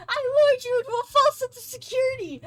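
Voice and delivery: high, loud gloating villain taunt; spring the trap like a cartoon villain; hammed-up villain. Playful fake villain ambush.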